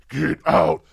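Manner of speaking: low, loud demonic command; haunted house voice. Retold or imitated. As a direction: boom it out like the house's ghost. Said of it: "he does the Amityville thing".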